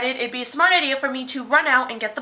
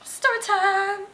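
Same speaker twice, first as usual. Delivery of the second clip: slow, drawn-out story time call, pitched up; story time announcer; 7 semitones higher, slower than usual. Acted. Vlogger opening her tale with a sing-song story time call. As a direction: announce it like a show title.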